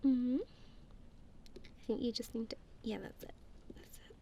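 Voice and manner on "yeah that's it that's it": whisper. Real, not acted.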